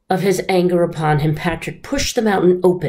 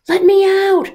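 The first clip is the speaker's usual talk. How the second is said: high, loud plea; crying out; 5 dB louder than usual. Acted. Snake trapped in the sinking box, high loud cry. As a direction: beg at the top of your voice. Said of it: "the snake cried".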